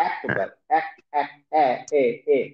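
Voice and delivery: আকবার then এক and a repeated এ, the vowel shifted from a to e; imala vowel drill. Shown as a demonstration. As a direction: repeat এ slowly, bending the আ toward e. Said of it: "আ উচ্চারণটা এ টাইপের করে".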